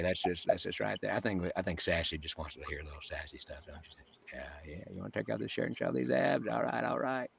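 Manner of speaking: hushed, low Texan impression; Matthew McConaughey. Shown as a demonstration. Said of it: "As far as Matthew McConaughey".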